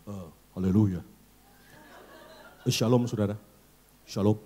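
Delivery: low, solemn pulpit voice; mock-grand bass greeting from the pulpit; bassy pulpit preacher. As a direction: drop deep like a preacher at the pulpit. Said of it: "Kalau di mimbar agak ngebas".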